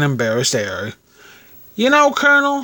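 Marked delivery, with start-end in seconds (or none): 1.8-2.6 high, coy landlady voice; murmuring landlady. A narrator's reading. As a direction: confide in the Colonel in a high voice. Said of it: "she murmured".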